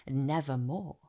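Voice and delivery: the raven answering in a low hush; raven's reply. A narrator's reading. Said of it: "meant in croaking".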